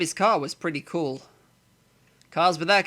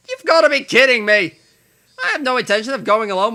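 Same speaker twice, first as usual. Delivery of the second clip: indignant shout; 6 semitones higher, 8 dB louder than usual. Acted. Protagonist indignant outburst.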